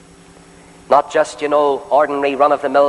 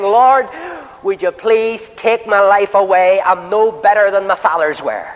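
Elijah's desperate plea; 6 semitones higher than usual. Retold or imitated. Preacher voicing Elijah begging God under the tree, pleading. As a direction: cry out the first word, then slow, pleading prayer.

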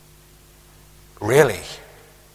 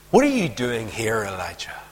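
"here" picked out in a low, soft question; quietly ask, leaning on "here", the name dropping to a whisper; stress on here; 6 semitones lower, 8 dB quieter than usual. Acted. God questioning Elijah in the cave, low and soft, weight on "here". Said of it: "With the emphasis on here".

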